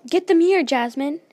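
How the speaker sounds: high, very loud urgent order; shouted command. A narrator's reading. Merida orders Jasmine mid-fight, shouting high and loud.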